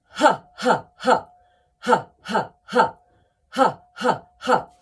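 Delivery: sharp, short, loud ha bursts from the belly; deliberate ha. Shown as a demonstration. Coach showing the sharp ha exercise, deliberate loud ha. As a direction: punch out each ha like a laugh. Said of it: "Let's try that together".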